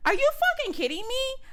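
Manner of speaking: very high-pitched incredulous outrage; retold going off. Woman going off on the driver, high incredulous outrage. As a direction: fire it back in high, offended disbelief. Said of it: "I went the fuck off".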